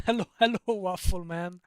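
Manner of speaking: loud, laughing hello; excited greeting. Real, not acted. Streamer greets Waffle Man in chat, loud and laughing. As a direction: greet him with a big laugh.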